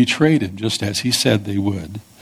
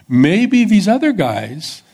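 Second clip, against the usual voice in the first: high, slow dismissive Peter; 9 semitones higher, 4 dB louder, slower than usual. Retold or imitated. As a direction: stretch "Maybe these other guys" high, slow and louder. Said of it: "Peter was speaking the truth that he believed".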